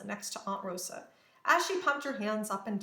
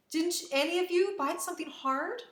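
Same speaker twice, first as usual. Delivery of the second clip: high-pitched, pleading question; anxious question; 8 semitones higher, 3 dB louder than usual. A narrator's reading. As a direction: ask with rising worry. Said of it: "Maria asked".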